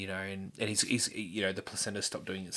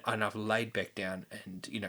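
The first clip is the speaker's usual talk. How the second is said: usual speaking voice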